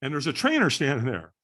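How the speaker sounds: usual speaking voice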